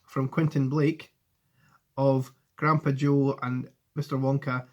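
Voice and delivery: ordinary delivery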